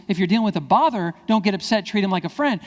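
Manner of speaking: ordinary delivery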